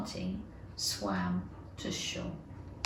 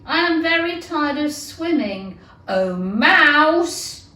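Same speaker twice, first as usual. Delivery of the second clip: calling out; 6 semitones higher, 7 dB louder than usual. Acted. Alice calls out to mouse.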